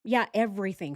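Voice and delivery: ordinary delivery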